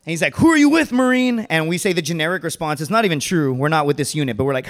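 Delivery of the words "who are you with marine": loud, high drill-sergeant demand; barked question. Retold or imitated. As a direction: shout it at a recruit. Agent interrogating the marines.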